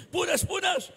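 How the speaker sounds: high, loud doting; fussy coaxing. Retold or imitated. Grandfather wiping the grandchild's knee, high and doting. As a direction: coo and fuss over the knee.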